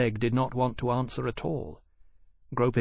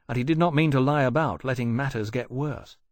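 usual speaking voice

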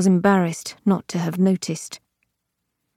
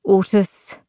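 usual speaking voice

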